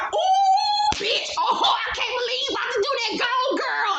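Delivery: very high squealing cheerleader hype; exaggerated high-pitched gushing; hyped-up imitation. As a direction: squeal like an overexcited hype man, mocking. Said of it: "hype as a motherfucker".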